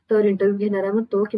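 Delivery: ordinary delivery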